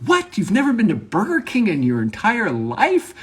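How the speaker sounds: very high, squealed disbelief; hammed-up shock, pitch soaring; mock astonishment. Hearing coworker never went to Burger King, mock astonishment.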